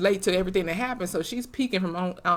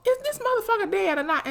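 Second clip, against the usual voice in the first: high, squeaky shocked question; can't believe he's alive; disbelief; 11 semitones higher than usual. Real, not acted. Viewer reacting to Ray popping up, high shocked disbelief.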